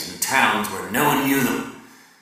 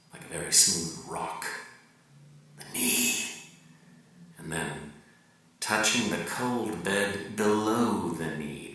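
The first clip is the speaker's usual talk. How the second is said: lower, softer and slow, almost whispered; hushed intimate narration; 5 semitones lower, 6 dB quieter than usual. Acted. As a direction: draw the listener close, slowly. Intimate erotic passage read hushed.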